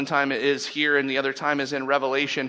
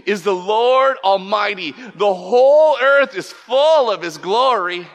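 loud, high calling of praise; 5 semitones higher, 7 dB louder than usual. Retold or imitated. Seraphim calling to one another around God's throne, calling out. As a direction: proclaim it loudly, each word ringing out. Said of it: "they were calling to one another".

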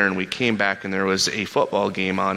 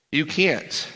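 ordinary talk, nothing marked

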